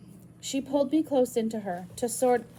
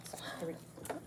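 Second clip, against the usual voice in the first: whispered; 5 semitones lower, 15 dB quieter than usual. Shown as a demonstration. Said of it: "I should act cringy".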